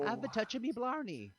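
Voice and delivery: hammed-up attempt at an Irish accent; bad Irish accent. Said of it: "Let me do my Ireland accent".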